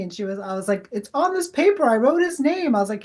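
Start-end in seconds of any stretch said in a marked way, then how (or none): 0.9-2.7 much higher, breathless with amazement; excited. Retold or imitated. Medium telling the daughter her father came through, excited. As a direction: tell her as if it just hit you. Showing proof on paper.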